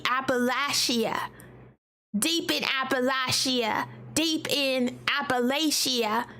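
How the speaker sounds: put-on Southern accent, slow and low; mock Appalachian drawl. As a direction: drawl it like a mountain local. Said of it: "how do they say it in Appalachia".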